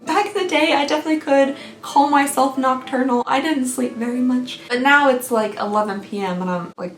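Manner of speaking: ordinary talk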